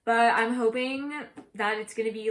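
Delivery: ordinary talk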